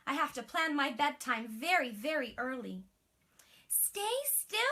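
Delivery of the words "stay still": cried. Acted. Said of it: "cried her mummy".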